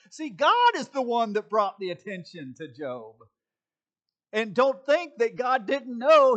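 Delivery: loud, high preaching; preaching hard. Real, not acted. Pastor preaching to his church, loud and high. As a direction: push the point home to the congregation.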